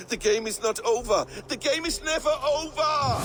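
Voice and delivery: defiant, rising to a shout; rising defiance. Acted. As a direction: push each line up into a manic cry. Loki's defiant parting words.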